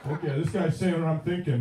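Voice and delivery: ordinary delivery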